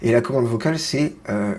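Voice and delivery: ordinary talk, nothing marked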